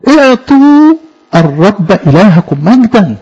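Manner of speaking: slow, measured verse reading; scripture recitation. Acted. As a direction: intone it slowly with weight on اعطوا.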